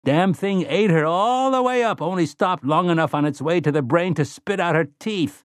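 high, gleeful gloating; morbid relish. Acted. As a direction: savour every gory detail. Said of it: "He sounded gleeful".

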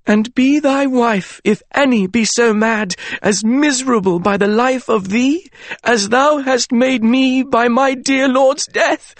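high, slow grieving declamation; voice cracking. Acted. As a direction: let the grief crack through. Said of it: "Her voice cracked".

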